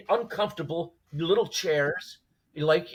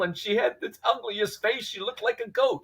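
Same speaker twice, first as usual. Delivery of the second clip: high, laughing retelling voice; amused storyteller voice; 8 semitones higher than usual. Retold or imitated. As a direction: tell it like a friend cracking up. Voicing the amused language assistant.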